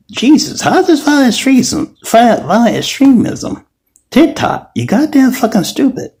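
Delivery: high, mocking outburst at TikTok; mocking rant at TikTok. Shown as a demonstration. Speaker mocks TikTok for viewers, high mocking squeal. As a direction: squeal it out like a ridiculing heckler. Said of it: "make fun of TikTok about".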